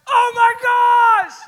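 very loud, high ecstatic yell; freaked out. Retold or imitated. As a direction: shout it out, overwhelmed with excitement. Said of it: "I freaked out".

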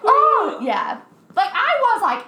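ordinary talk, nothing marked

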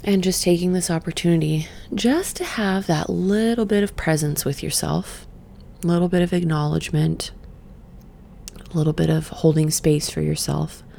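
quiet meditation voice, a touch breathy. Acted. Leading viewers to check in, soft meditation voice. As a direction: invite them to notice, softly. Guided noticing, softer.

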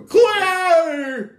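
high, loud, stretched yell; exaggerated high-pitched holler; mock calling out. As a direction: imitate a guy calling across the water. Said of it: "the guy would go".

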